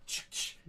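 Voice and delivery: unvoiced "ch-ch" sound effect; imitated click-clack noise. Storyteller imitating what he heard as police arrived, click-clack noise. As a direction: mimic a sharp double clack. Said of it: "the first thing I heard was".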